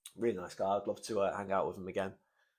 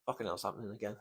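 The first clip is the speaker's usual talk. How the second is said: quiet, choked-up aside; holding back tears, softly; getting emotional; 11 dB quieter, faster than usual. Real, not acted. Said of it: "I got a bit emotional".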